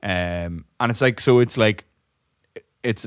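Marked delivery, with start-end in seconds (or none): none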